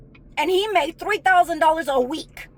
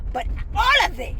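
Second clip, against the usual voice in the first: real outburst; 5 semitones higher than usual. Real, not acted. Ranting to the man who used her, real crying shout. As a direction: let it break out, crying out the repeat.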